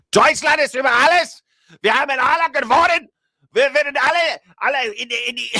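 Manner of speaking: put-on high, shouted German accent rant. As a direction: bellow it like a rally speech in a German accent. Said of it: "in a German accent".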